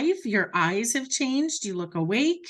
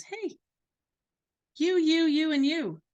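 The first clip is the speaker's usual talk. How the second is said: calling out; 6 semitones higher, 4 dB louder than usual. Retold or imitated. Picking people out of group.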